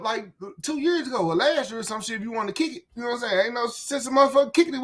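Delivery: normal talking voice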